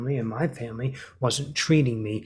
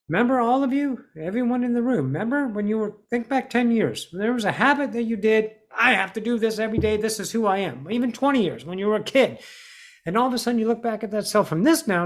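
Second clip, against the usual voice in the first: lifted, higher preaching voice to the whole room; rally the room to remember, pitched up; rousing speaker; 8 semitones higher, faster than usual. Acted.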